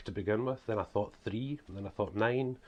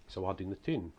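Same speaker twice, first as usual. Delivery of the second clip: strong northeast Scots, "doon the toon"; broad local Scottish accent; broad Scottish dialect. Shown as a demonstration. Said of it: "That's, that's how we speak".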